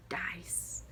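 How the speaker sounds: soft voiceless whisper of Dice; whispered word. Shown as a demonstration. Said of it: "sounding out the word".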